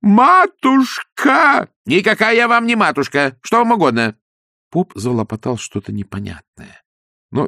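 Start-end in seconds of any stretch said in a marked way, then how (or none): 0.0-1.7 gushing priest. Acted. 1.9-4.2 indignant wife. Acted. Wife rebuffing priest.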